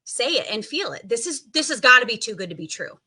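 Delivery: ordinary talk, nothing marked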